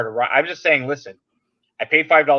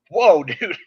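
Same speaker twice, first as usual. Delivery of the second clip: louder, higher laughing whoa; laughing mock whoa; 6 semitones higher, 7 dB louder than usual. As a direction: laugh off the threat. Mock reaction to blacklist threat.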